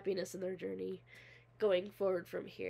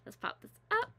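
excited; 8 semitones higher than usual. Real, not acted. Excited start of the video.

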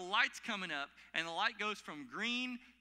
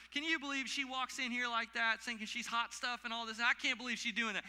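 mock judgmental, higher voice; higher-pitched, scandalized busybody act; critical gossip; 5 semitones higher, 3 dB louder than usual. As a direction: imitate someone running a person down in disbelief. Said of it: "we get real critical".

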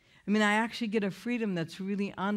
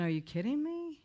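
higher, incredulous retold reaction; stunned disbelief; 7 semitones higher than usual. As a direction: ask it as if you can't believe your ears. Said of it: "And I'm thinking".

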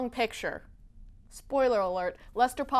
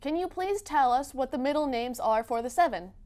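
ordinary delivery

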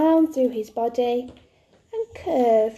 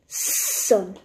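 "Sun" with a long drawn-out s; slow clear demo; 4 semitones lower than usual. Shown as a demonstration. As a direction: stretch the first sound of "Sun". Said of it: "Listen carefully".

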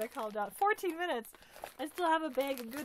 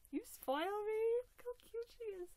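high, fast coo; 10 semitones higher than usual. Real, not acted. Cooing to the giver about the doll, high coo.

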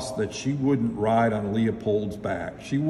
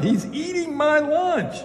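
high, wailing storybook yell; chief's hammed-up howl of protest; outraged shout. A narrator's reading. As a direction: cry out in outrage, pitched way up. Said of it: "the chief shouted".

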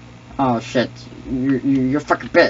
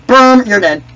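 high, loud kill call; triumphant shout; 8 semitones higher, 9 dB louder than usual. Pretend. Announces a kill.

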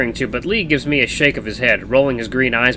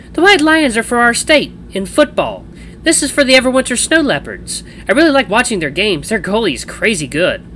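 high-pitched, offended sports fan; voiced character, pitched well up and put out; 11 semitones higher, 4 dB louder than usual. A narrator's reading. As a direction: indignantly set them straight in a high voice. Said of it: "with an offended expression".

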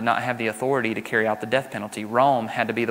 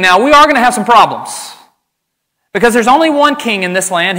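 much higher, louder, anxious interrogation; fearful Pilate; 9 semitones higher, 9 dB louder than usual. Retold or imitated. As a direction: press him like a nervous governor. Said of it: "his political fear coming to the surface".

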